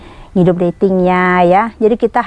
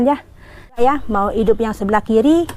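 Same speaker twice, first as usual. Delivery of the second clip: usual speaking voice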